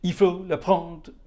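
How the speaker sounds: labored French read-aloud that falls apart. Real, not acted. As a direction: stumble through French lyrics, losing the rhythm. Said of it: "I can't do it in rhythm".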